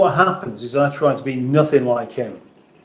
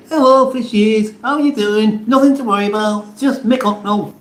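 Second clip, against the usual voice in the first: squeaky high cartoon voice for the fishies; silly fish voice; 8 semitones higher than usual. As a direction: pitch it way up like a tiny fish. Greeting the fish tank.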